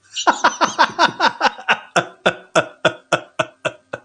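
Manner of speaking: big burst of laughter; loud laugh. Real, not acted. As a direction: crack up laughing.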